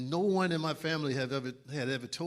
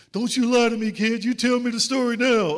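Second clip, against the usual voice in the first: loud, much higher demand; stern coach scolding; 9 semitones higher, 11 dB louder than usual. Retold or imitated. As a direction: lay into the kid, no excuses. Coach confronting player in office.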